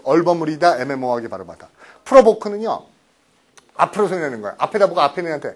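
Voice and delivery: ordinary talk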